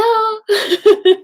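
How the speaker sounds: delighted shrieking giggle; high burst of laughter. Real, not acted. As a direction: break into a giddy laugh. Laughing at sneak peeks.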